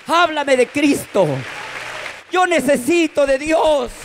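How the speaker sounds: put-on high, insistent drunken plea; pleading drunk. Drunk man begs the preacher for God, high plea. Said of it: "viene totalmente tomado por el alcohol".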